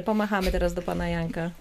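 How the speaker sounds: ordinary talk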